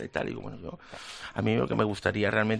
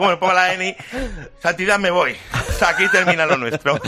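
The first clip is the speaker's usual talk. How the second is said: laughing mock farewell; 8 semitones higher, 9 dB louder than usual.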